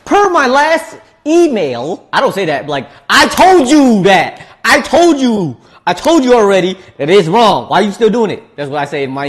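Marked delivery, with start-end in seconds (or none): 0.0-2.1 email voice. Retold or imitated. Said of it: "I'll be like". 3.1-8.4 fed-up email voice. Retold or imitated. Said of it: "I'll be like".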